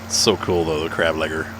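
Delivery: ordinary talk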